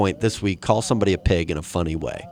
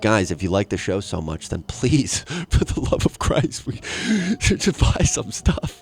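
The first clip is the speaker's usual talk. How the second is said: mock begging voice. Mock pleading listeners for money.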